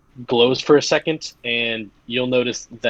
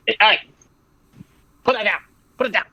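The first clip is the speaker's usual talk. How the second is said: sudden high, urgent call-out; real scolding; 9 semitones higher, faster than usual. Real, not acted. Game master interrupts play to scold the dog, urgent scolding. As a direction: break off and scold the dog from across the room.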